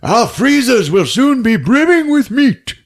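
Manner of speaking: high, loud gloating boast; hearty villager. Retold or imitated. Piiparinen bragging about the meat, loud high voice. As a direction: promise it grandly. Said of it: "Piiparinen's like".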